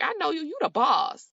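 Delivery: mock-excited Kathy greeting; imitating Kathy excited. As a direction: play up Kathy's eager delight. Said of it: "she was like".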